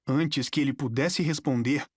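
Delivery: ordinary delivery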